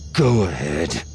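biting down on pain, gritted teeth; through clenched teeth. Acted. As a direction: force it out past gritted teeth. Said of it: "Voss said through clenched teeth".

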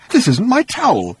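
high, indignant bewilderment; puzzled complaint. Acted. Arthur rummaging in his bag, puzzled complaint.

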